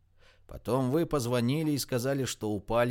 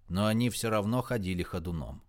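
usual speaking voice

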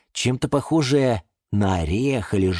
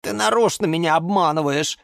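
mild grumble in a higher character voice; grumbling; 9 semitones higher than usual. Acted. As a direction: grumble that you are being fooled. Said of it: "пробурчал Жорж". (ворчливо)

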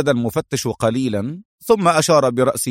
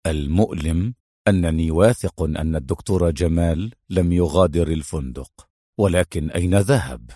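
low-pitched, brooding agent; troubled frowning hero; 6 semitones lower than usual. A narrator's reading. Adham puzzling over the missing doctor, troubled low voice. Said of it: "قطب أدهم حاجبيه وقال".

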